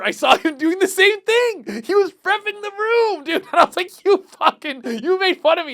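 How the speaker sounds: high, loud mock outrage; outraged retelling. Comic telling off his copycat roommate, high outraged yelling. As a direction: re-enact indignant yelling at a hypocrite.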